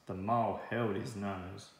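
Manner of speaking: ordinary delivery, nothing marked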